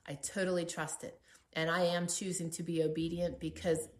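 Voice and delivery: ordinary talk, nothing marked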